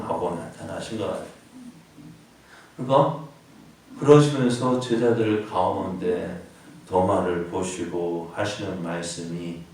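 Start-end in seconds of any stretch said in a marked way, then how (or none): none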